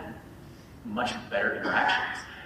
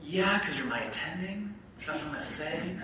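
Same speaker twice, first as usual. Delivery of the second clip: self quote; 7 semitones higher, 3 dB quieter than usual. Retold or imitated. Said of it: "And I was like".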